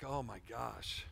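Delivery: awed hush. Retold or imitated. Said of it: "It's like".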